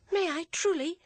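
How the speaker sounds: put-on high, delighted little girl; eager young girl. A narrator's reading. Girl asks her father eagerly, high and delighted. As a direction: ask with hopeful delight.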